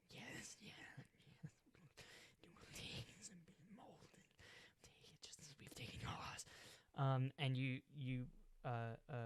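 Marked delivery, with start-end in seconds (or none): 0.1-6.5 whispered voices of a dark god; eerie whisper. Acted. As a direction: hiss it like chanting spirits. Thousand voices of Typhon.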